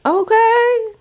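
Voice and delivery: high squeaky startled okay; taken aback. Retold or imitated. Vlogger recalling her shocked reply to the man, high squeak. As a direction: recoil with a nervous high okay. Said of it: "I was like".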